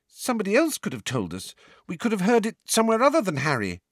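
put-on high, fast girl's protest; Ginny protesting. A narrator's reading. Ginny arguing with Sirius, high and hurried. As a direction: rush it out in a high young voice.

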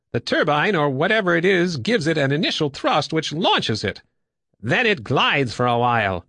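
high, lively explanatory character voice; explaining pilot. Acted. Woodbine explaining how the turbine works, high and animated.

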